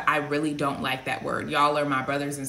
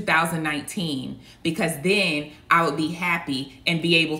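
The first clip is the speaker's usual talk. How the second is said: building sermon, louder and emphatic; preaching; 4 dB louder than usual. Acted. Prophet preaching to her viewers about letting go of the past, rising emphasis. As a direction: preach it, driving each point harder.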